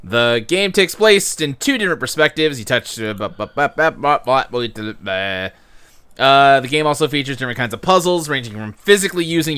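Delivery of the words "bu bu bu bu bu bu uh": low, soft "buh-buh" filler noises; trailing mumbled bup-bup-bup while skimming; skimming babble. Real, not acted. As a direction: babble quietly past the boring text. Skips through text being read aloud.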